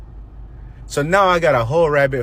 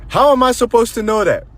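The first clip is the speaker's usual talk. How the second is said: much higher and louder, stunned; shocked disbelief; 11 semitones higher, 4 dB louder than usual. Retold or imitated. Son answering his mom on the phone, shocked disbelief. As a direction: blurt it out in total shock. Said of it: "I was like".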